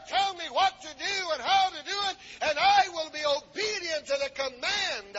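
high-pitched preacher building to a shouted peak; impassioned preaching. Acted. Preacher urging congregation to obey God, impassioned preaching. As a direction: preach with rising fervor and punched words.

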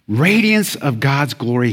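ordinary talk

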